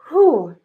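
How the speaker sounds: high, loud exhaled whew; emotional whew. Real, not acted. Card reader about to cry over a chosen soul, emotional whew. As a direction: blow out a whew against welling tears.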